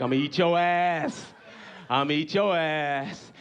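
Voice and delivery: low, drawn-out menacing bear; bear's threat. Retold or imitated. Bear counting its prey, low threatening voice. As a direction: threaten it low, stretching the last word. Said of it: "That bear's like".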